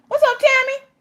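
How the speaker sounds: squeaky high-pitched hello to a viewer; high greeting voice. Acted. Streamer greeting a chat viewer, squeaky high voice. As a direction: chirp the viewer's name way up high.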